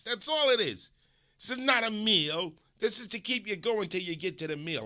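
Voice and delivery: lightly put-on New York accent; New Yorker. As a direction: lecture like a New York pizza guy. Imitating New Yorker pizza lecture.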